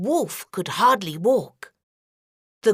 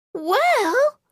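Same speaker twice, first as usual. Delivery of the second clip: put-on higher, questioning girlish voice; girl eyeing the bed, higher; suspicious girl; 12 semitones higher than usual. A narrator's reading. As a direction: ask it doubtfully like a wary child.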